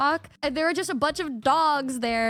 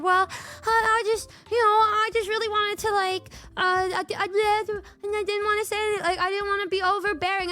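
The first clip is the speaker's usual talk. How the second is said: put-on hesitant girlfriend; 5 semitones higher, faster than usual. Retelling Michi's hesitant excuse.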